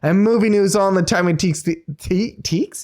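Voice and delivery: upbeat podcast host welcome; show host intro. Acted.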